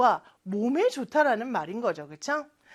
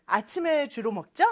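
usual speaking voice

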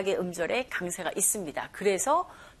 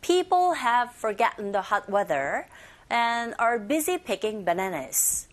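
slow, stretched reading of the sentence; slow sentence model; slower than usual. Shown as a demonstration. Teacher shares the whole sentence for learners to repeat, slowly. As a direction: read slowly, word groups drawn out. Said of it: "한 번 더".

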